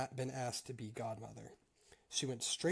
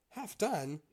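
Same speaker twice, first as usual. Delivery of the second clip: higher, incredulous question; surprised echo; 7 semitones higher, 5 dB louder than usual. A narrator's reading. Mouse doubting the cat's strange name, surprised. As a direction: throw the name back in mild disbelief.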